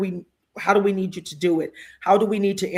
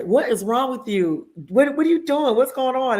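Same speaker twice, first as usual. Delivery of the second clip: alarmed drivers; 6 semitones higher than usual. Retold or imitated. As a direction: voice the startled drivers, rising in panic. Drivers alarmed at her screaming.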